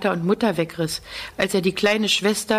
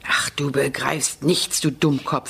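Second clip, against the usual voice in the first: lower, slow, bitter scolding; böse und bitter; angry; 4 semitones lower than usual. A narrator's reading. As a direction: cut the little boy down in anger. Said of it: "sagt Janusz böse".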